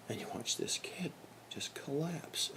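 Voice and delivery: hushed whisper; whispered, slowing on collapse. Real, not acted. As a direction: drop to a whisper to let it sink in. Kid crushed by father.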